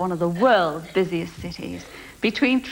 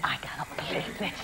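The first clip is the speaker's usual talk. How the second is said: softer, lower, teasing copy of her line; mock hushed echo; 8 semitones lower, 13 dB quieter than usual. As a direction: parrot her words back in a hushed mock tone. Host echoes contestant's words.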